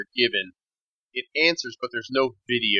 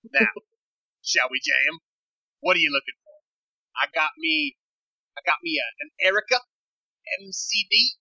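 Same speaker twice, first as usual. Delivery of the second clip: higher-pitched female dealer voice; intense woman dealer; 8 semitones higher than usual. Acted. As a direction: play a sassy gear dealer, pitched up. GM plays Missus Jam.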